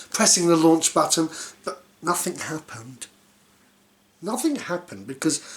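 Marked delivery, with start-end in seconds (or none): none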